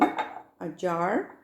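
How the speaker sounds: normal talking voice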